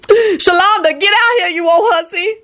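high-pitched holler; calling out. A narrator's reading. Woman calling Shalonda in to look, hollering. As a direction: summon her from the next room.

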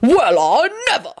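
high-pitched outraged sputter; indignant bellow. A narrator's reading. Husband scorning the gardener rival, outraged and high. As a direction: splutter with high, scandalised indignation. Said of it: "Richard bellowed, his face red".

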